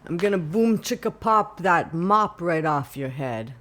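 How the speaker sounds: loud Sicilian father voice; threatening Sicilian dad accent. Retold or imitated. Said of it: "Like someone's Sicilian father".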